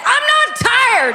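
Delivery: high, slow, loud protest; defensive shout. Retold or imitated. Husband shouting back at wife, high slow protest. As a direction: insist loudly you're awake. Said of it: "Why don't you just go to bed?".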